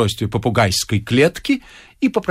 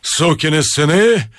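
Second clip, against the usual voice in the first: blustering; 4 semitones higher, 4 dB louder, slower than usual. Acted. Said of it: "хорохорился".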